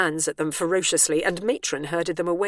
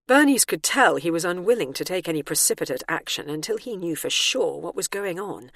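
ordinary delivery

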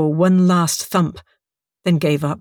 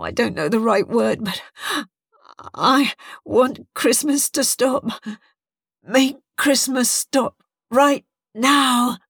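halting, gasping plea, rising higher; weak and faltering; 4 semitones higher than usual. A narrator's reading. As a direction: grope for words while running out of breath. Collapsing Bernice begs to stop Christmas.

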